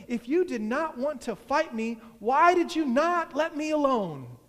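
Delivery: high, loud exasperation building to the end; annoyed protest. A narrator's reading. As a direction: protest with rising irritation, loudly.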